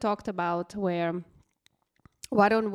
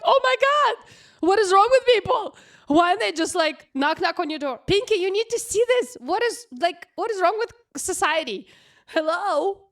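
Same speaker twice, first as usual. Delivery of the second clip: exasperated loud rant; 11 semitones higher, 6 dB louder than usual. Retold or imitated. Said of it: "I'm like".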